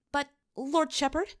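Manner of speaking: put-on high, pleading young woman calling out; a nervous girl calling out to the shepherd; young lady's voice. A narrator's reading. Young lady calls from pews.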